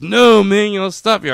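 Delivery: high, loud hero mimicry; mock heroic outburst. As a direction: cut in as an over-insistent hero. Flash's refrain interrupts, more forcefully.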